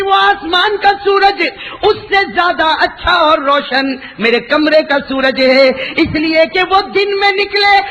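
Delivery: high, ringing sermon delivery, slowed for effect; full-force preaching. Acted. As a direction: proclaim it to the crowd with rising fervour. (پورے جوش سے اونچی آواز میں وعظ)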